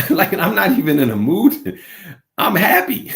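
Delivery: loud, high protest through laughter; laughing protest. Retold or imitated. Man tells partner he isn't angry, laughing in protest. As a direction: insist loudly while cracking up.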